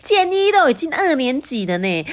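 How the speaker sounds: scolding mother. Acted. Mother scolding the father for wanting to tag along, exasperated. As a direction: tell him off, fed up.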